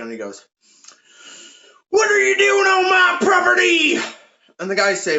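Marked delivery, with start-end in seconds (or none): 1.9-4.0 high, loud hillbilly holler; hillbilly farmer. Acted. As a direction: shout like an old farmer with a shotgun. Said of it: "this old farmer hillbilly man comes out".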